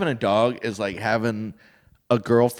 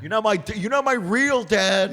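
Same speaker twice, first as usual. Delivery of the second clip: sulky stepkid; 9 semitones higher than usual. Acted. Stepson dog snapping at his stepdad, high sulky whine. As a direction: pout like a resentful teen.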